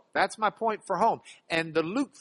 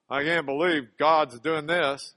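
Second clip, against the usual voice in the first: a hint of a grumbling Pharisee, lower; 3 semitones lower, 4 dB louder than usual. Preacher acting the Pharisee shocked by Jesus, lightly voiced grumble. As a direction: mutter like a scandalised Pharisee. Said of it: "the Pharisee grumbling".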